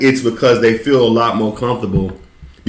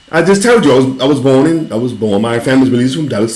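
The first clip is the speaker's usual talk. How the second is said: normal talking voice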